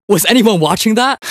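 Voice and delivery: high, bright-eyed bragging call; excited brag. Acted. Agent bragging to the team, excited. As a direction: show off the big play, pitch way up.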